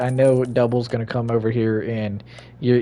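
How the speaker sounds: usual speaking voice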